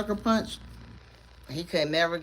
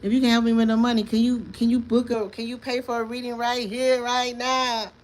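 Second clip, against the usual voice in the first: pleading for money; 6 semitones higher than usual. Retold or imitated. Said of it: "I'm like".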